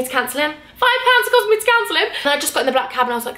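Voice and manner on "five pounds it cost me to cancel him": exasperated repeat. Real, not acted.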